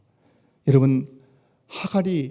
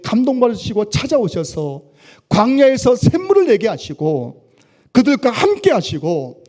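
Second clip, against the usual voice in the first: louder, higher fervent preaching, slowing at the end; preaching at full force; 5 semitones higher, 5 dB louder than usual. Acted. Pastor preaching to his congregation in full fervour. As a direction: swell into the climax with fervour. Builds sermon climax.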